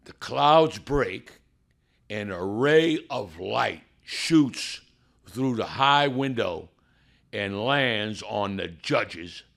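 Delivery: loud, slow, building testimony; slow, swelling preacher's build to the miracle; preaching climax. Acted. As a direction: preach it, each phrase bigger.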